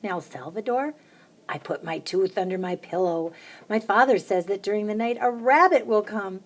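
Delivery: ordinary delivery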